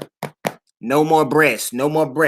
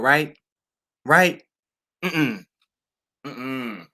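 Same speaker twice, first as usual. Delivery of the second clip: usual speaking voice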